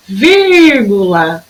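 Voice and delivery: first syllable of "vírgula" held very long, the rest quick; stressed first syllable. Shown as a demonstration. Teacher showing pupils the accent, stretched "vír" in "vírgula". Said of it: "Olha lá o acento em vírgula".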